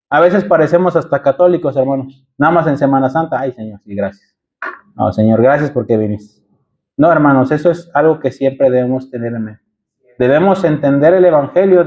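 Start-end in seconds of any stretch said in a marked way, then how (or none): 3.4-6.5 mock a lazy once-a-year prayer; mumbled mock thanksgiving; half-hearted mumbled prayer. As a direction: lower and softer, a bored token thanks.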